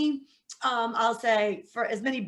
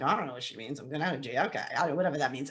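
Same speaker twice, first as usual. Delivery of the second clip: confused inner voice; 8 semitones lower, 4 dB quieter, much faster than usual. Retold or imitated. Young self confused inner voice.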